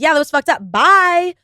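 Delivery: loud, high, dismissive send-off; dismissive brush-off. Acted. Friend dropping someone who messed up, dismissive. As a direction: cut them off with a big drawn-out bye. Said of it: "Instead of being like".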